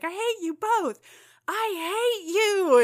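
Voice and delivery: put-on high, slow teen snarl; furious teen Kitty. Kitty screaming at her parents, high slow hammed-up fury. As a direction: spit it out like a raging teen. Kitty's wild panel quote.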